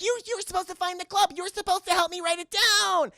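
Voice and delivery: high, whiny complaint; mock bickering girl. Mocking the leads arguing, high whiny nag. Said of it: "and how it's like".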